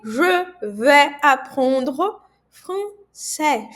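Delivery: Je vais apprendre français, slow and loud; repeat-after-me demo; slow French model sentence. Shown as a demonstration. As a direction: model it for repetition. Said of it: "Repita comigo".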